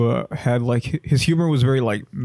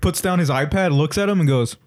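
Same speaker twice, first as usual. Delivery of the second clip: ordinary delivery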